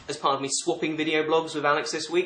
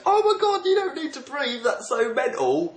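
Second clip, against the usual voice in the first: very high, louder mock amazement; over-the-top Bella freak-out; 11 semitones higher, 4 dB louder than usual. Reader mocking Bella's reply to Edward, hammed-up squealing amazement. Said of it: "Bella goes".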